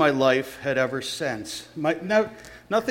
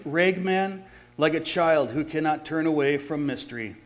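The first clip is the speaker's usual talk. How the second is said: plain reading voice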